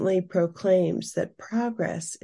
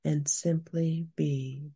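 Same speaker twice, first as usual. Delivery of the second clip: guided meditation. Acted. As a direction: guide the listener gently into calm. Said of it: "Now we will move into our guided meditation".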